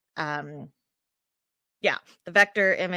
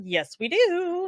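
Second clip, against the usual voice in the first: slow, playful sing-song; 10 semitones higher, slower than usual. Pretend. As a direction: draw it out playfully. Playful drawn-out reply.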